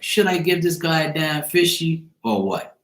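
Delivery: put-on imagine a child weighing whether to share; lightly voiced little girl. Girl sizing up the man asking for her snack, lightly voiced little girl. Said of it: "she's kinda looking at me like".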